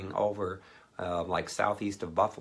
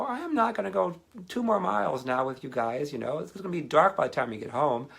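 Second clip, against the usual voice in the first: exasperated refusal; 9 semitones higher, 4 dB louder than usual. Retold or imitated. Refusing to walk further.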